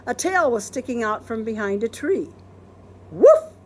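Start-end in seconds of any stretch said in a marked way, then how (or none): 3.1-3.5 loud high woof; barking dog. Acted. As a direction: bark like a dog. Dog barks.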